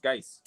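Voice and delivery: Geis with a long eye vowel, rhyming with ice; corrected pronunciation. Shown as a demonstration. Co-host correcting the player's name, Geis said to rhyme with ice. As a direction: say Geis as one syllable with an eye sound. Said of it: "I think his name's pronounced".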